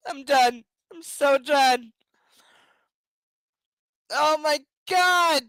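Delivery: loud, slowing exasperation building to a shout; real fed-up groan at the book; exasperated outburst. Real, not acted. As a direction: done with this, rising to a cry.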